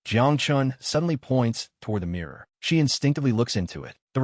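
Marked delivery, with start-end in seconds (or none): none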